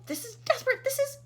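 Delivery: high, loud desperate cry; loud panicked yelling. A narrator's reading. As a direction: sound frantic. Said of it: "yelled ground control".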